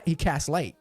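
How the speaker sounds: usual speaking voice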